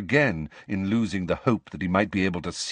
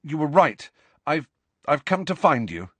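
put-on higher, insistent boy's voice; undeflected; 6 semitones higher, 3 dB louder than usual. A narrator's reading. Harry will not let Nick deflect him, insistent. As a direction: press on, pitched up. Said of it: "refusing to be deflected".

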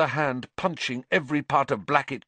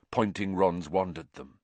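ordinary delivery

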